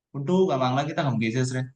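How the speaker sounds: usual speaking voice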